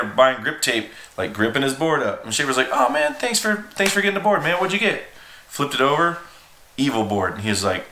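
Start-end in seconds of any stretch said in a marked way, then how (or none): 2.7-5.0 high, upbeat cheery thanks; friendly greeting. Retold or imitated. Shop owner thanking a kid, cheerful before the shock. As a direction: greet him bright and friendly.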